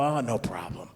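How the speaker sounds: soft, breathy meek reply; mock-meek customer, hushed; too nice. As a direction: give in almost under the breath. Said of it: "we're all just too nice".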